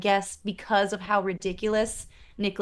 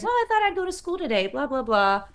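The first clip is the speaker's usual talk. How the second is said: much higher, fast, airy brush-off; breezy nonchalance; 6 semitones higher than usual. Retold or imitated. Peggy shrugging off time travel, mock breezy. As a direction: toss it off like nothing happened. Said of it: "She comes downstairs".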